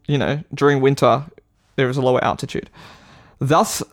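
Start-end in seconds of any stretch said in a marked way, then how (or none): none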